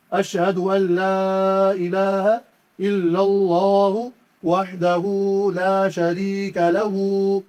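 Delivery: slow chanted kalima, each word drawn out; slow Quranic recitation. Shown as a demonstration. Teacher recites the whole kalima for students, slow chant. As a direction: recite it like in the mosque, unhurried.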